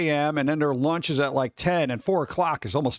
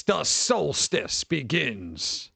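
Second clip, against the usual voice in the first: movie trailer voice; 2 semitones lower, much slower than usual. Pretend.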